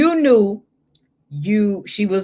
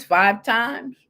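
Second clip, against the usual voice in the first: ordinary talk, nothing marked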